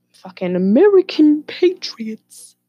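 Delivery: exaggerated patriotic sneer; mocking patriots. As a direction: drag it out in a hammed-up patriot voice.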